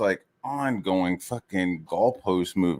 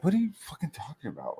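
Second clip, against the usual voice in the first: not even mad; 7 semitones higher, 8 dB quieter, faster than usual. Retold or imitated. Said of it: "not even mad".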